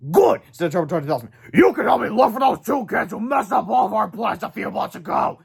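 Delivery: high, loud monster. A narrator's reading. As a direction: growl out orders like a beast. Turbo Toad voiced.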